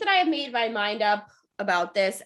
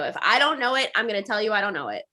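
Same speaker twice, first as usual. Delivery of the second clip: ordinary talk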